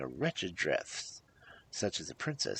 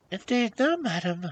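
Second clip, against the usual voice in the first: high, raised princess voice; poor sad princess; 10 dB louder than usual. A narrator's reading. Princess declining supper from the hag, sad. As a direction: protest sadly, pitched up.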